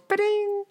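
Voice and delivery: high video game "ba-ding"; chirpy Mario coin chime; Mario sound effect. Pretend. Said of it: "Mario sound".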